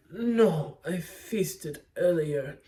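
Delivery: slow, drawn-out vampire imitation; mock Dracula voice. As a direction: play the count, stretching the words slowly. Voicing Dracula declining dinner.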